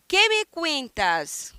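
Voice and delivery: slow, lifted model question. Shown as a demonstration. Teacher models the question for students, slow and clear. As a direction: draw the question out slowly for the class. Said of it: "teacher models the question slowly".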